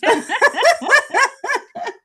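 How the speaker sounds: bright burst of laughter, rising; high loud laugh. Real, not acted. To the host, laughing about reporting back on spoons.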